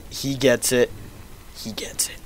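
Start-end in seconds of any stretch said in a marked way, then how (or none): 1.6-2.2 soft mock-secret whisper. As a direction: whisper the line softly. Repeat whispered after plain take.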